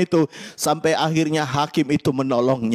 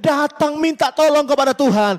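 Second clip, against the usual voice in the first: full-force preaching; 8 semitones higher than usual. Acted. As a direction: preach it to the back row, rising in pitch and volume with every promise. Altar call at full force.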